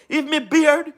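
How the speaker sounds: ordinary talk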